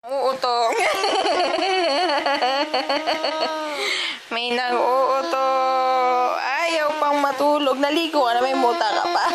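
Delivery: put-on high, loud sing-song baby talk to a toddler. Mom coaxing her sleepy toddler to bed, in high baby talk. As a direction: coo at the little one like a doting mom.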